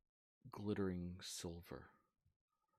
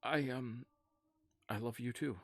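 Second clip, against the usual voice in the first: higher, louder hesitant character voice; halting, pitched-up reply; flustered Alum; 5 semitones higher, 6 dB louder than usual. Acted. Alum returns a dear friend's love, flustered.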